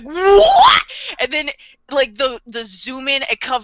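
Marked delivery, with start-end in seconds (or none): 0.0-0.8 much higher, loud laughing scream of disbelief; shocked shout. Real, not acted. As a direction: yell out in sheer shock. Everyone's shock at the gunshot.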